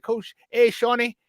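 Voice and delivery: high-pitched imitation of a North Carolina accent; North Carolina coach accent. Former player mimics coach hailing him, North Carolina accent. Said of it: "he talks like this".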